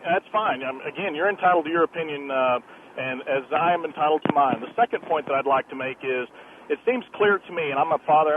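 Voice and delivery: ordinary talk, nothing marked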